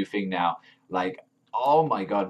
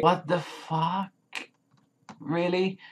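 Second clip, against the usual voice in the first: high, drawn-out disbelief; incredulous; 9 semitones higher, much slower than usual. Real, not acted. Fan baffled by the praise for Haru, incredulous. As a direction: question it in baffled high pitch.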